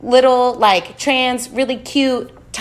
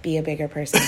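retold self-calming; 4 semitones lower, 6 dB quieter, faster than usual.

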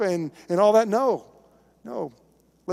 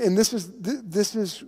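ordinary talk

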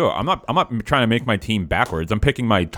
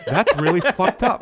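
laughing; 9 semitones higher, slower than usual. Real, not acted. Cracking up at what happened.

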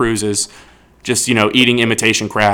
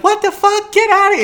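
much higher mock shriek of an angry girl. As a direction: squeal it like she is chasing him out. Girl voiced yelling in story.

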